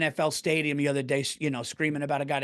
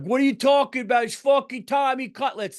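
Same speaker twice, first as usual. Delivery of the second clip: much higher, louder, brash fan impression; mocking New York fan; 10 semitones higher, 6 dB louder than usual. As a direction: blurt it like an outraged loudmouth fan. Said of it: "New York fans that drive me nuts".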